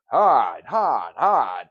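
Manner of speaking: hard, hard, hard hit loud and blunt each time; hard punched cadence. Shown as a demonstration. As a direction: bang out each hard like a hammer. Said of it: "his cadence was pretty raw".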